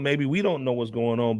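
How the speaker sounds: usual speaking voice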